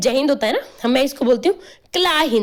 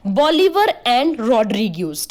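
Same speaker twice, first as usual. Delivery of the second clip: slow naming; 2 semitones lower, much slower than usual. Shown as a demonstration. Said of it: "teacher stresses scientist names".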